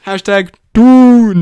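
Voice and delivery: loud, slow, hugely stretched last word; hammed-up intoning. Host reading out fan hashtags, hammed-up intoning. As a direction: boom out the final word and hold it.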